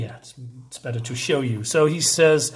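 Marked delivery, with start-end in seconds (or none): none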